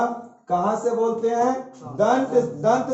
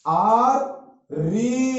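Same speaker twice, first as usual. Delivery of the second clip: very slow, the syllable held long; slow drawn-out demo; 2 semitones higher, much slower than usual. Shown as a demonstration. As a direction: draw out री for the class. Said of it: "री पढ़ाए थे स्वर वर्ण में".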